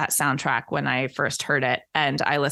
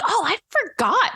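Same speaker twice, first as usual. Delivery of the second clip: jumps way higher on a startled oh; sudden realization. Retold or imitated. Recalling the Friends reference she forgot, pitched high and startled. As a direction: catch yourself remembering something you'd missed.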